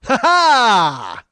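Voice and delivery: loud high mock maniac laugh; crazy laugh. Comic laughing in the yelling man's face, loud and high. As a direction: laugh in his face to unsettle him. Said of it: "And I just went".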